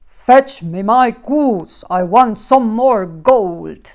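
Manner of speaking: loud, slow booming giant command; giant demanding. Acted. Giant ordering the old woman to fetch his goose. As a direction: bark the order like a greedy giant. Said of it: "then demanded".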